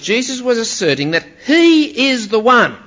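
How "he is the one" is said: "he" held high and loud; stress on "he". Shown as a demonstration. Preacher asserting what Jesus meant, stress on "he". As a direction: assert it, punching "he". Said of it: "emphasis is very emphatically on the".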